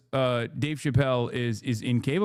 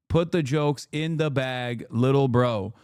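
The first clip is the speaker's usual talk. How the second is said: ordinary delivery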